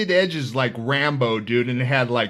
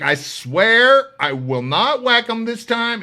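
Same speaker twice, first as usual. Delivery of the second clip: high, slow, overly sweet promise; mock pleading; 9 semitones higher, 5 dB louder, slower than usual. Inmate sweet-talking the guards for a new cellmate, slow mock pleading. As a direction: plead innocently, dragging each word out.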